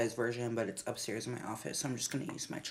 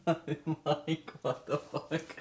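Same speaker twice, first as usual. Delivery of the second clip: laughing; much slower than usual. Real, not acted. Laughing about burrito fiber.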